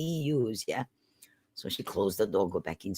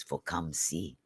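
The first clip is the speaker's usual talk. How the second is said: ordinary delivery, nothing marked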